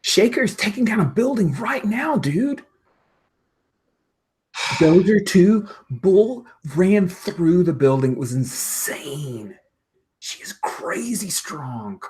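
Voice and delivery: high-pitched awed fan, trailing into slow hushed wonder; high excited voice fading to an awestruck near-whisper; excited gushing. Acted.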